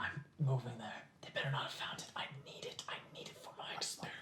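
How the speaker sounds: very soft, hurried whispering; furtive whisper. Acted. Hiding and worried about a needed item, furtive whisper. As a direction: whisper anxiously under your breath.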